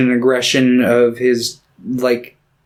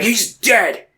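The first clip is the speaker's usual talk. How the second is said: mock outburst; 10 semitones higher than usual. Faramir voiced announcing brother's death.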